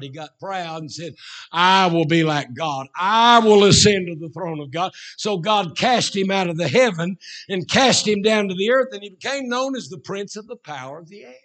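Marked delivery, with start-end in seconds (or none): 1.5-4.9 loud, boastful declaration as Satan; proud proclamation. Acted. 5.2-11.3 loud, driving pulpit narration; full-force preaching. Real, not acted.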